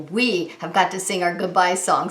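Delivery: usual speaking voice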